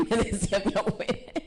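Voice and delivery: said through a laugh; amused, laugh breaking into the words. Real, not acted. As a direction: chuckle while recalling it. Laughs recalling grandmother's saying.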